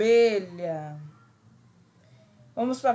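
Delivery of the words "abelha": Abelha drawn out slowly, low. Shown as a demonstration. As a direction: stretch out Abelha slowly, lower pitch. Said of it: "Vamos lá, de novo".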